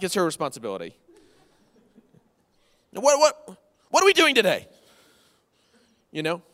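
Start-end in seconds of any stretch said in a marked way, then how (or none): 2.9-4.7 higher and louder, mock irritated; mock snippy husband. Pastor imitating himself snapping at his wife, hammed-up. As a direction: whine like a cranky bored husband. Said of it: "I'll be snippy with her".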